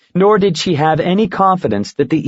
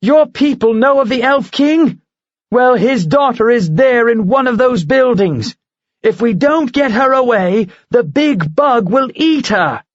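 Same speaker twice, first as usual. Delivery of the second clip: higher, urgent pressing; urgent plea; 11 semitones higher than usual. Acted. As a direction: talk right into his ear. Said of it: "talked loud and fast".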